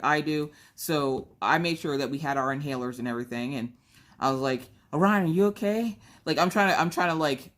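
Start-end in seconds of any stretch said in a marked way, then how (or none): 4.9-6.0 fake casual concern. Mom covering her own panting, calling to her son. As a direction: act fine while hiding your panting. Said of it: "divert everybody's attention off of me huffing and puffing".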